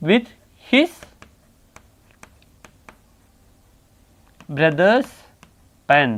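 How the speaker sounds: one word at a time, long gaps; slow dictation. Real, not acted. Teacher writing the converted sentence for class, slow dictation. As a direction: dictate each word separately and wait.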